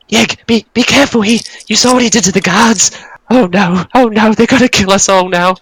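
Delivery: high, frantic goblin voice; a cowering goblin begging not to be killed; panicked goblins. Acted. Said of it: "you hear the others go".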